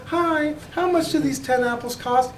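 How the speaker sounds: high, chirpy shopper asking the price; polite customer. Acted. A customer asks the Trader Joe's clerk the price, high and polite. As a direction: sweetly ask the clerk what the apples cost.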